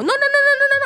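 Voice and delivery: high, rapid string of alarmed cries; panicked child. Retold or imitated. Child caught by the reversing car, high mock panic. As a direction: imitate a kid's rising panic.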